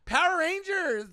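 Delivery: high, loud mock drunk blurting; mimicking a random heckler, hammed up high; random drunk shout. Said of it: "me just randomly saying".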